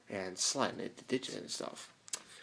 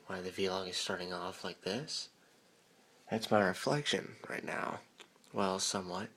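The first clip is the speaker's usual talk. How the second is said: ordinary talk, nothing marked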